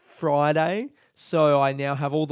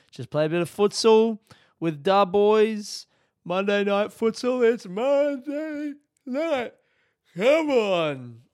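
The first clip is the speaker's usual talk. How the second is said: excited; 7 semitones higher than usual. Real, not acted. Hyped about futsal night.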